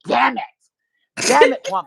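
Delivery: loud, high comic outburst; mock exasperation. Cornered by coworker's church invitation, loud mock exasperation. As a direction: curse in hammed-up dismay.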